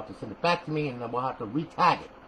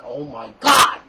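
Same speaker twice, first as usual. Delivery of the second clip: exasperated; 7 semitones higher, 8 dB louder, slower than usual. Retold or imitated. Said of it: "and I'm like, going like".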